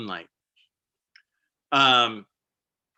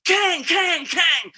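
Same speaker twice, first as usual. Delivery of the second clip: very high ringing "Kang, kang, kang"; metal clang imitation; 6 dB louder, much slower than usual. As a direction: ring it out like wood hitting a metal hull. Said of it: "this is a metal boat".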